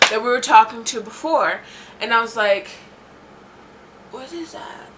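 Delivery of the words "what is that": very soft, high, wary question; tiny retold voice of dread; hushed unease. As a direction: ask it under your breath, creeped out. Retelling reaction to a strange name.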